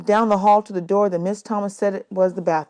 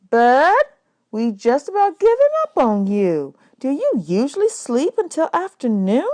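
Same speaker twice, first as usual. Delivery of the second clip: high, lively woman's voice, louder; teasing woman; 6 semitones higher, 4 dB louder than usual. A narrator's reading. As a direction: tease the sleepy boy gently. Miss Thomas teasing the boy.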